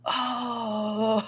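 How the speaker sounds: long drawn-out onlookers' "ohhh"; imitated crowd "oh". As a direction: draw out a crowd's wince, imitated. Imitates onlookers seeing the fall.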